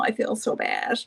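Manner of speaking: usual speaking voice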